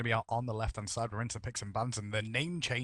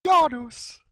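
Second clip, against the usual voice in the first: high, loud name call; hammed-up announcer; 13 semitones higher, 13 dB louder, much slower than usual. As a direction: belt the name out like a hype announcer. Hams up the name.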